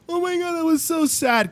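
high-pitched pretend tearful reaction; put-on high sad wail; mock sad crying. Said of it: "kind of cry".

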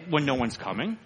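usual speaking voice

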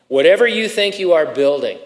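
ordinary delivery

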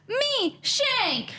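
high, playful ad-spokesperson voice; high commercial voice. Pretend. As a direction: chime in like a TV ad. Mock sponsor pitch.

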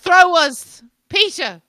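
loud, high Irish call; Irish accent. Retold or imitated. Imitating pottery show host, calling in Irish accent. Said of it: "that one Irish lady on the pottery show".

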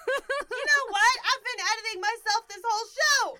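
high, shouted rant with laughter in it; loud, hammed-up confession; mock outrage. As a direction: yell it out, half laughing. Mock rant about self-censoring.

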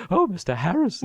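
high falsetto impression of a quavering crooner; adoring mock falsetto. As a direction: gush in a put-on, very high voice. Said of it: "none other than [name]".

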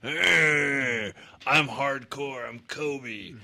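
jaw jutted out, slow tough-guy brag; underbite. Retold or imitated. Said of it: "stick his lower jaw out and be like".

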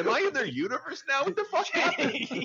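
high, laughing disbelief; mock bewildered. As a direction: squeak out the confusion, laughing. Mock confusion at the movie.

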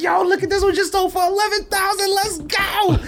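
very high, breathless thrilled voice; hyped. Retold or imitated. Man showing his wife the resale price, very high and hyped. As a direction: show off the sale, barely able to breathe. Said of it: "I'm so hyped".

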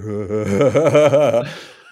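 loud, hammed-up cackle; imitated eerie laugh. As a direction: let out a creepy nighttime laugh. Imitating laugh heard at night.

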